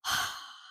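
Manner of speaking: a long breathy exhale of relief; an unvoiced sigh after the final stretch; relieved out-of-breath sigh. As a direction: breathe out like you just finished the run. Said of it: "I'd be like".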